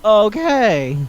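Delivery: drawn-out, high, uneasy okays with long pauses; acted awkward, stunned reaction; weirded-out acceptance. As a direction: stretch each word in mock discomfort. Acted stunned reaction to her confession.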